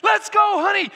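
high, loud sideline yell. Retold or imitated. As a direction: shout him down the court. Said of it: "we just started yelling".

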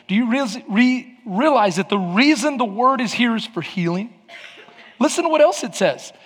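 normal talking voice

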